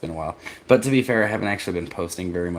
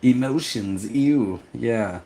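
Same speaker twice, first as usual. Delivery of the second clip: higher-pitched, playful "ew" of distaste; mock disgust; 4 semitones higher, much slower than usual. Streamer reacting to talk of feelings, in mock disgust.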